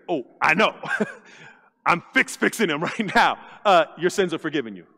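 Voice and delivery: low voice with a chuckle; amused Jesus. Retold or imitated. Jesus amused at the crowd, chuckling low. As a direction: say it lightly, laughing a little. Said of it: "like, fix him".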